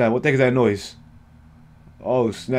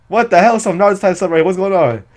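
loud, higher-pitched burst of disbelief; startled outburst; 6 semitones higher, 4 dB louder than usual. Real, not acted. Reacting to Naruto type stuff, startled and loud. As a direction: react in high, loud surprise.

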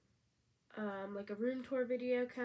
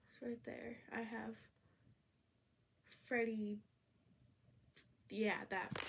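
sniffling; 4 dB quieter than usual. Real, not acted. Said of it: "sorry about all the sniffling".

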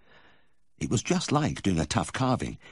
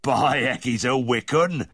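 high, loud Yorkshire exclamation; Yorkshire farmer; 7 semitones higher, 5 dB louder, slower than usual. A narrator's reading. Farmer watching the lively calf, loud Yorkshire exclamation. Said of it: "Wick is Yorkshire for lively".

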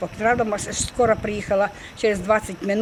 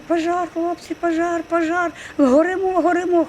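raised, higher-pitched cry of alarm; retold shouting; 7 semitones higher than usual. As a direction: call out to wake the household, retelling it. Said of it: "і я кричу". (вигук тривоги, переказаний високим голосом)

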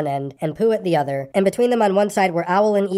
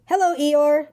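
small excited voice; 8 semitones higher, slower than usual. A narrator's reading. Roo's small high voice.

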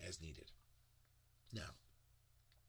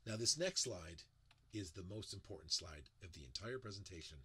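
normal talking voice

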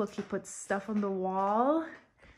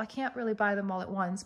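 normal talking voice

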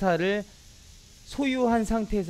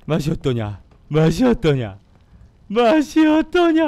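taunting; 3 semitones higher, 10 dB louder than usual. Real, not acted. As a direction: build the taunt up each time. Taunts the beaten boss, three times.

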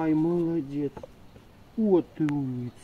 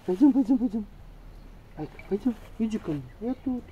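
talking to a dog; 6 semitones higher, much faster than usual. Real, not acted. Coaxing dog to follow.

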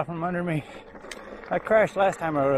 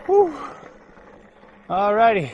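relieved whoop; 7 semitones higher, 7 dB louder than usual. Real, not acted. Relief after tricky section.

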